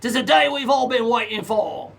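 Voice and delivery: slow, light pirate voice. Pretend. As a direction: ham up a pirate greeting. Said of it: "I guess I'm an English pirate".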